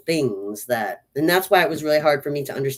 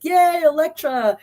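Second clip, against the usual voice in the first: mock cheer; 13 semitones higher, 4 dB louder than usual. Cheering for a hero's cameo.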